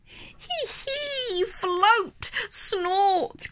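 high, soft giggling squeal; piglet giggles. Acted. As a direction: giggle like a delighted toddler. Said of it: "giggles George".

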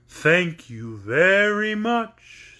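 drawn-out, unhurried straw man; slow scarecrow voice. A narrator's reading. Scarecrow answering Dorothy, slow and drawn out. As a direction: stretch every word like a lazy scarecrow.

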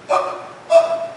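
short unvoiced gasps, high and strained; gasping for air. Shown as a demonstration. Preacher shows the congregation a suffocating gasp. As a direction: gasp as if the heat steals your breath. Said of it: "trying to get your breath like that".

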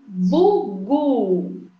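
BUGU slow and split as Bu, gu; syllable by syllable. Shown as a demonstration. Teacher reading syllables aloud to children, Bugu split in two. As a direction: say "Bugu" in two drawn-out beats. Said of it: "Falar a sílaba em voz alta".

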